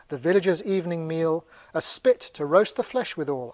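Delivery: declaimed, rhythmic verse reading; projected poem with a steady beat; verse recitation. Acted. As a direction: recite it grandly, line by line. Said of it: "This is the verse".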